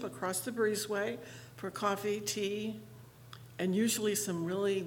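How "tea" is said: normal talking voice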